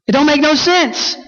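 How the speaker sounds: high, twangy mock yell; Pete's yell. Preacher quoting Pete's outburst at Everett, a high mock yell. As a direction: throw it out like an exasperated hillbilly. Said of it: "Pete yells this great moment".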